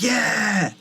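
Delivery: high excited cheer; crowd cheer. Acted. Crowd cheering outside, high excited yeah.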